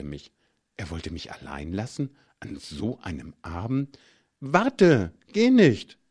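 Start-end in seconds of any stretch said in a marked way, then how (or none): 4.4-6.0 high, loud sudden plea; urgent cry, much higher and louder; blurted plea. Acted. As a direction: it bursts out before you can stop it. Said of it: "entfuhr es mir".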